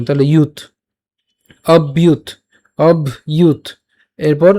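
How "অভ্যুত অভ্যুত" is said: "অভ্যুত" in two clear parts, obh-yut, stopped on t; closing t. Shown as a demonstration. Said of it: "তটাকে আগে উচ্চারণ করলাম".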